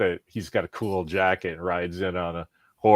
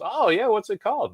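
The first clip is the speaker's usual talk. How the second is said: put-on old guy stranger; 13 semitones higher than usual. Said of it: "He goes".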